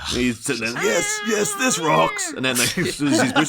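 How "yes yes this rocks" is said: high, ecstatic mock cheer; rocking out. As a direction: squeal along to the music. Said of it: "rocking out in his car".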